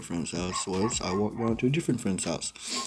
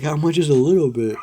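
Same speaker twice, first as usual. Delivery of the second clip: stern dad; 5 semitones higher, 8 dB louder than usual. Retold or imitated. Dad questioning.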